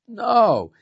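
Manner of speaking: amused denial. Retold or imitated. Rabbi's retold answer to his students.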